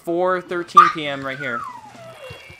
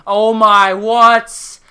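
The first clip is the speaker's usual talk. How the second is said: shocked outburst; 6 semitones higher, 10 dB louder, slower than usual. Real, not acted. Surprised at a bad match.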